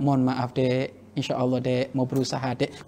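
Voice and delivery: calm her down softly; gentle reply. Retold or imitated. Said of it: "dengan cara yang lembut".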